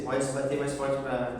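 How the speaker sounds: usual speaking voice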